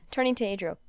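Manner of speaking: usual speaking voice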